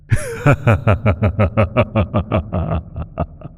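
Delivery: a drawn-out, high sinister cackle; long high sinister cackle; long evil cackle. Acted. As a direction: cackle with delight at their danger. Patron mocks mortal caution.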